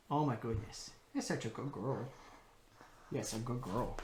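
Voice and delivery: low, soft cooing to a dog; tender praise. Real, not acted. Praising the puppy.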